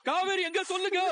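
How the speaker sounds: frantic high anguish; anguished demand. Acted. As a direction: beg them to tell you, breaking. Demanding after death news.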